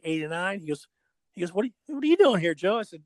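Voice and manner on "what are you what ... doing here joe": high, fast, surprised, a bit louder; imitating a friend's startled hello; surprised greeting. Old friend surprised to see him at Nationals, fast and high.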